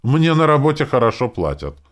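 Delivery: high, loud, huffy protest; indignant. A narrator's reading. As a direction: answer back as if insulted. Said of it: "возмутилась она".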